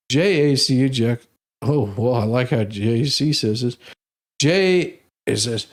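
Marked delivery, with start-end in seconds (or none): none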